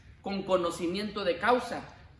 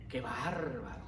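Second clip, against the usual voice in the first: low, half-whispered mock reproach; hushed mock disapproval; 7 semitones lower, 10 dB quieter, slower than usual. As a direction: imitate a scandalised churchgoer under the breath. Acted disapproving sister's muttered reproach. (reproche fingido, casi susurrado)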